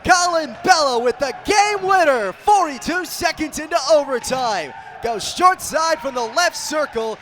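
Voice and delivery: a huge, high roar on "scores!" that stays loud through the recap; game-winner shout. Real, not acted. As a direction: scream the winning goal at full force. Overtime game-winning goal call.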